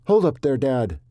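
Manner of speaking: loud frustrated shout; angry holler. Acted. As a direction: holler angrily across the room. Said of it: "Colt hollered, anger and frustration".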